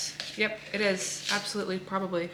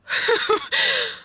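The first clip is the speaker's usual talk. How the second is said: high giddy laugh; 14 semitones higher, 3 dB louder than usual. Real, not acted. Overwhelmed joy at gym.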